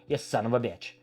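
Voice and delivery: usual speaking voice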